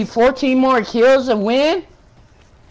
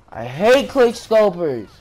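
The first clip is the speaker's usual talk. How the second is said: ordinary talk, nothing marked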